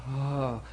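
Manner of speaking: low, soft sighed "oh"; heavy-hearted sigh, acted out; dejected sigh. Preacher showing what we usually do, dejected sigh. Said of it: "we usually do the".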